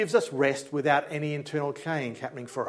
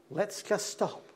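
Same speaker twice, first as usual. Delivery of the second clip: gentle voice of God; 4 semitones higher, 6 dB quieter, slower than usual. Acted. God voiced inviting rest.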